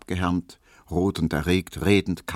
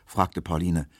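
ordinary delivery, nothing marked

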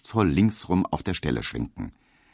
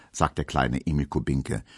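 usual speaking voice

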